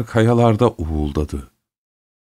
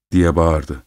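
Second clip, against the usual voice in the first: plain reading voice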